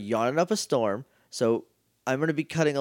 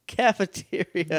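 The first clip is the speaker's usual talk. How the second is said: mocking repeat; 3 semitones higher, slower than usual. Shown as a demonstration. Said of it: "Jake, what?".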